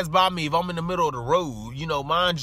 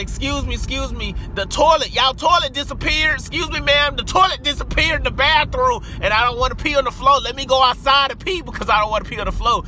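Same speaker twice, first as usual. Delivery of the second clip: high, loud, rushed panicked plea; retelling a frantic dash out of the bathroom; 6 semitones higher, 4 dB louder than usual. Retold or imitated. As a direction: beg them in a rush, voice up high.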